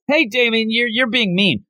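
high-pitched imitation of a girl scolding. Girl telling Damien he's being mean, high mock scolding. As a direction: lightly act out a girl telling him off. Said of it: "even the one girl's like".

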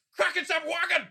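high, harsh mock German shout. The German take in a word meme, high mock German. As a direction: spit it out like the German meme version. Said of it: "And then they get the German".

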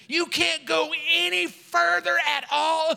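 high-pitched, incredulous mock telling-off; scolding chief. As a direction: play the outraged fire chief. Chief berates crying recruit.

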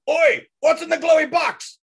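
high, slow shout. Acted. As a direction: call it down from up high. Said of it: "I will shout down".

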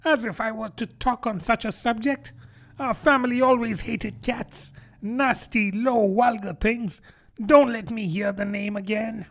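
high, piping, offended squeak; outraged mouse voice, pitched well up; indignant mouse. A narrator's reading. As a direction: rant as an affronted little mouse. Offended mouse speaks in character.

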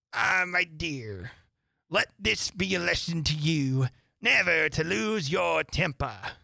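slow, drawn-out moralising voice; old crab mother. A narrator's reading. Old Crab lectures her daughter, slow motherly admonishment. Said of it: "an old crab took the opportunity".

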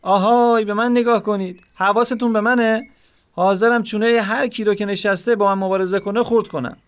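high-pitched character cry of the father; desperate shout. A narrator's reading. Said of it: "اون با ناامیدی تمام فریاد زد". (فریاد ناامیدانهٔ پدر)

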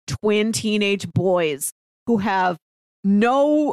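normal talking voice

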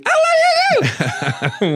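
very high, slow falsetto with a laugh; slow mock screech. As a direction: stretch it out in a squeaky screech. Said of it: "screeching hippopotamus".

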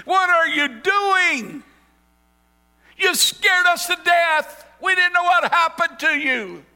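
high, loud, slow reproach from a frightened mother; mother bursting into the circle, scolding Jesus; anxious scolding mother. Acted. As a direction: scold him out of relief and fear. Frantic mother scolds lost son.

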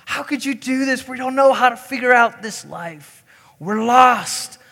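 high, pleading mock distress; panicked disciples. Disciples begging Jesus not to leave, acted-out panic. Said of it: "they're saying".

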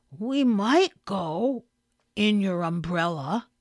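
slow, measured, at an easy volume; slow repeat. Acted. Bear repeats his umbrella idea, slow and measured. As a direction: repeat the idea patiently, pausing midway.

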